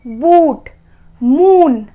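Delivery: usual speaking voice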